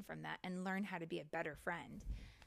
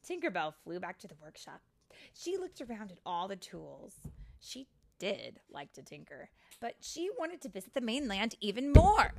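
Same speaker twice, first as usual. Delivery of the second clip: animated storybook reading, building at the end; lively, expressive storytime voice; story narration for children; 14 dB louder than usual. A narrator's reading. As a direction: read it to little ones, punching key words.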